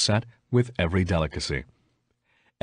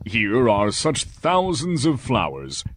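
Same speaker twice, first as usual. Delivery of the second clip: merchant musing; 3 semitones higher, slower than usual. A narrator's reading. Merchant talks to himself.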